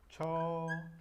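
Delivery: "chopping" with a long held cho, pause, then ping; drawn out in syllables. Shown as a demonstration. Teacher shows the gerund of chop, drawn out. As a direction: split "chopping" slowly into two level syllables. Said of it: "chop quedaría".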